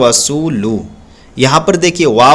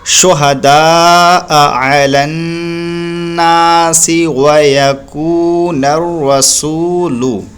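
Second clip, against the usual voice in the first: slow, long-held tajweed chant; Quran recitation; much slower than usual. Shown as a demonstration. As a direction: chant the verse slowly, holding vowels long. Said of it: "ये sentence हुआ इस तरीके से".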